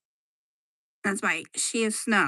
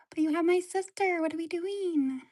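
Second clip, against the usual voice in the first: pitched-up pet complaint; higher squeaky pet voice; 8 semitones higher than usual. Pretend. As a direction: voice the pet higher, a little indignant.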